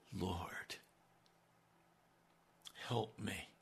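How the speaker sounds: soft, hushed begging cry; quiet plea. Acted. Woman kneeling before Jesus, begging in a hushed plea. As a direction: plead quietly, almost broken. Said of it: "I want you to hear. That's a cry".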